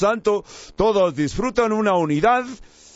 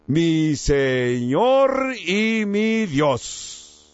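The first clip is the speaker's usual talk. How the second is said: drawn out very slowly, word by word; solemn confession; much slower than usual. Retold or imitated. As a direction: quote it as a weighty declaration of faith. Tomás' confession quoted with solemn weight.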